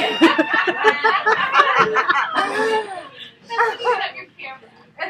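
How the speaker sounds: long shrieking laughter; loud laugh. Real, not acted. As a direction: scream with laughter. Laughs at son story.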